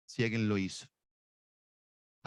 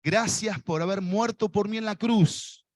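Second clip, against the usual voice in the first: led prayer; 6 semitones higher than usual. Shown as a demonstration. Preacher leading the congregation in prayer, fervent. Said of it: "Decile, por favor".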